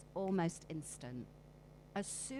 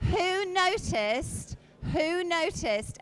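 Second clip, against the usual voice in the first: calling out; 10 semitones higher, 10 dB louder, slower than usual. Real, not acted. Calling out loudly to room.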